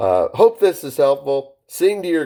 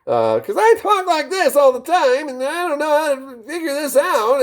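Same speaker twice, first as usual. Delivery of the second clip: much higher, squeaky whine of a trainee; squeaky caller voice; 6 semitones higher than usual. Retold or imitated. Trainer voicing a student worried about a squeaky voice. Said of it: "Well, you say".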